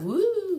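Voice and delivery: high, loud held whoop; wild, high-pitched hoot; high excited "woo". Acted. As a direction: let out a long rising "woo". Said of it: "Sometimes I'm like".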